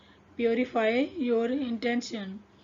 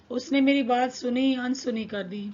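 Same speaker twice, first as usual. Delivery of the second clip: ordinary talk, nothing marked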